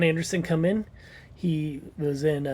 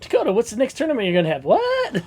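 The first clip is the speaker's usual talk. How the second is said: higher, hammed-up questioning, building to a loud high "What?"; mock questioning; 6 semitones higher, 5 dB louder than usual. Host asking absent Dakota about his tournament, mock questioning. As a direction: play at grilling someone who is absent.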